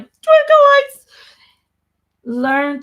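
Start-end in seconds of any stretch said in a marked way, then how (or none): 0.0-1.1 much higher and louder, thrilled cry; excited squeal. Retold or imitated. Lighting up the room, she squeals with delight. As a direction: burst out like a delighted child. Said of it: "I'm like".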